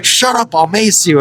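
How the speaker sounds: high-pitched, exasperated retold warning; mock angry cop. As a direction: imitate a fed-up cop silencing a kid. Cop snapping back at the teen.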